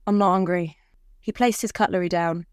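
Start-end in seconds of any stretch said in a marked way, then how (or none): 0.1-0.8 husband voice. Acted. Husband refuses dinner.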